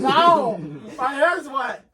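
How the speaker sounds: high, loud laughing protest; laughing shout. Real, not acted. Got splashed with water.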